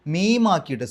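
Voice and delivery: normal talking voice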